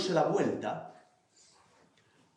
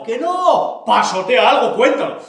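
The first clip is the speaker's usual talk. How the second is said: insistente; 6 semitones higher, 11 dB louder, slower than usual. Acted. Manuelona insiste.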